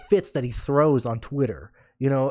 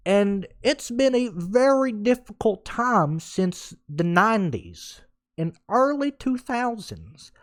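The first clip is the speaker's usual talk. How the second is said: high, slow whiny fan voice; mock impression of a complaining radio caller, pitched up and slow; 7 semitones higher, slower than usual. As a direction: drag it out like a moaning superfan. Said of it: "reenacting how he was talking".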